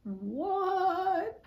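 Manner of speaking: baffled, mock-shocked what; stunned what. Reading the book, reacting in disbelief: high incredulous what. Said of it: "And I was just like".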